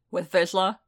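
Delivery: pointed question, pushing for the answer; pressing. A narrator's reading. Boba suspects Vizsla hurt Din, pressing him. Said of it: "he pressed".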